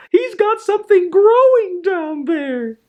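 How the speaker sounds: the nurse yelling down the hall in shock; hollering nurse. Acted. Nurse cries out over the earache, high alarmed holler. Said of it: "she hollered".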